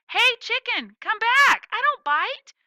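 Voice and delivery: high, loud call after a runaway chicken. Acted. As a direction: shout it after the chicken, coaxing. Calling after a running chicken.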